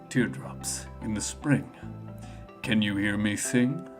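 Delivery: low, soft, halting verse; solemn poem recital. Acted. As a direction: recite quietly and gravely, line by line. Espio recites his poem.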